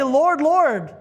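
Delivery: loud high plea at the door; desperate cry. Acted. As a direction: beg to be let in. Pleading at the door.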